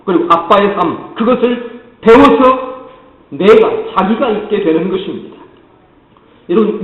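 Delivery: normal talking voice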